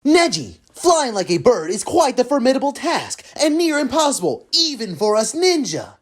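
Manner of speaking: low earnest training voice; deep, proud martial artist; Rock Lee. Acted. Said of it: "I, Rock Lee".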